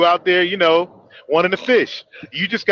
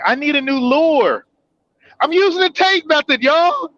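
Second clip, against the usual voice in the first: hammed-up girly plea; 8 semitones higher than usual. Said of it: "And then next thing".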